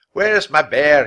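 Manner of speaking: loud furious bellow; loud, angry bear owner roaring into the woods; angry shout. A narrator's reading. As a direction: yell it at the thieves. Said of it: "he shouted".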